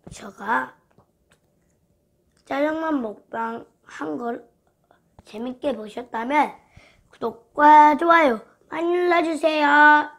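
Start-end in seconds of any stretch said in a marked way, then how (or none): 0.1-6.5 talking between bites of noodles; speaking while still swallowing food; mouth full, chewing. Real, not acted. Seen 0: Lips pressed, cheeks working over the noodle bowl, like mid-chew.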